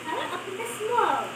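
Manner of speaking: talking with a mouthful; words round a bite of fruit; eating sour candy. Real, not acted. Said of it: "eating while talking".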